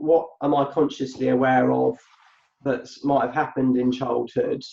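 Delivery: ordinary talk, nothing marked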